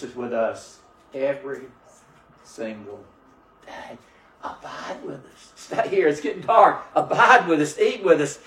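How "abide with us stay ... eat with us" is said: impassioned prayer rising higher and louder; pleading with the Lord, building to full force; preaching prayer. Acted. Pastor praying over the congregation, impassioned and building.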